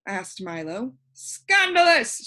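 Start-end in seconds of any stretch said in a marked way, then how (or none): 1.1-2.3 loud, high, slow outraged shout. A narrator's reading. King outraged that everyone left, shouting. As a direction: bellow it in royal outrage. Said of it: "shouted the king".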